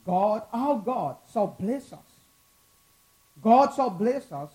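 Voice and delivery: loud, rising scripture reading; dramatic scripture reading. Acted. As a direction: proclaim the psalm to the congregation. Reads the psalm aloud with force.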